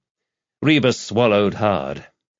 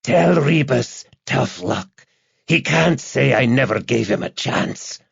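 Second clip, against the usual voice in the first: slow, raised hissing voice; menacing hiss; 5 semitones higher than usual. A narrator's reading. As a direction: draw the taunt out in a hiss. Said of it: "Then the hiss returned".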